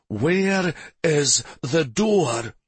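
slow, high demanding growl. A narrator's reading. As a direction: demand it slowly, menacing. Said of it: "Stavin demanded as he growled".

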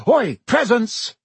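high, loud, drawn-out holler; excited wake-up call; excited shout. A narrator's reading. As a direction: call out with glee to wake a friend. Boy wakes friend for presents.

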